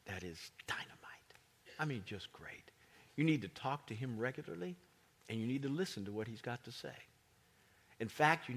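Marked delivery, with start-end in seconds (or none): 0.0-2.7 slow, softer whisper of delight; whispered awe about private devotion; whispered aside. Real, not acted. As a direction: lean in and whisper it like a secret. Confiding aside in sermon.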